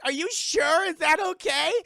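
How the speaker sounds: high, loud worried questions; alarmed doubt. Retold or imitated. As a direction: shout it as an uneasy crowd. Everyone doubts the shot.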